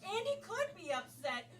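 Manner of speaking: normal talking voice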